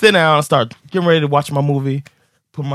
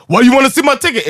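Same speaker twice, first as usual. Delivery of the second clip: higher, louder mock outrage; indignant challenge; 10 semitones higher, 5 dB louder than usual. Passenger to train staff asking for ticket, indignant challenge. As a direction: challenge the inspector, voice jumping up.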